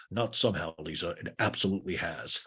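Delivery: lightly voiced low, grumbling owner; a hint of a mean boss, kept low; Greg. Mean owner complains to Lisa, lightly voiced low grumble.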